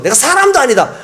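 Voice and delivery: loud, high furious swearing; cursing denial. Retold or imitated. Peter swears angrily at the crowd, loud and high. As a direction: swear it off in panic. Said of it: "아주 심하게 욕을 하는 거죠".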